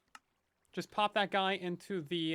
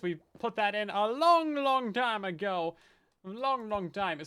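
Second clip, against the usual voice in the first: drawn out; 2 semitones higher, 6 dB louder than usual. Acted. Drawn-out storytelling.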